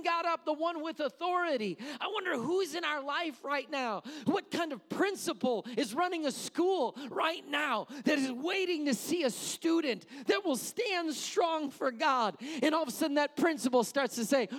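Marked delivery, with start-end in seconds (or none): none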